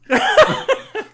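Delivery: shrieking laugh; loud high laugh. Real, not acted. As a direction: burst out laughing. Big laugh at joke.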